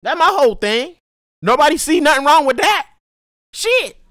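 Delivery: high, loud frustrated outburst; venting at full volume, real anger; real angry rant. Real, not acted. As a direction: shout it out, fed up with everyone. Ranting about the parking lot.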